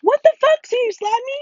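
very high, loud disbelief; real outraged yell. Real, not acted. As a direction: shriek in shock at being hit. Yells after being slapped in game.

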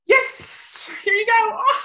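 very high, airy marker "Yes"; high marker word. Shown as a demonstration. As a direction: chirp the yes up high to the dog. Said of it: "marker demo to dog".

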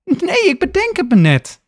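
high, sharp irritated retort. Acted. Lena answering Max's question, irritated and high. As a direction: snap back at a pointless question. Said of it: "reageert Lena geïrriteerd".

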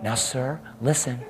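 soft, restrained official voice; calm, polite request. Shown as a demonstration. How the attendant did not speak, soft and polite. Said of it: "Not like".

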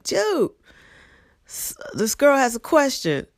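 high, lazy call across the desk; high-pitched Jersey buddy calling out; coworker's call. Retold or imitated. Coworker calling to the narrator about the student. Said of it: "he's like".

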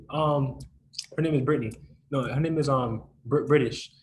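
usual speaking voice

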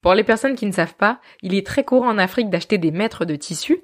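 ordinary talk, nothing marked

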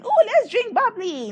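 squeaky high delighted voice; mock excited contestant. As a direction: play a giddy girl finding champagne.

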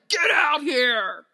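loud, drawn-out yell at a ghost; mock taunting shout. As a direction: yell it like an overdramatic ghost hunter. Mimics hosts taunting a ghost.

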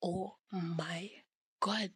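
breathless whispered oh my God; shocked whisper. Retold or imitated. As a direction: whisper it, stunned by the twist. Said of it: "be like".

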